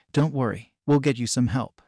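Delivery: ordinary delivery, nothing marked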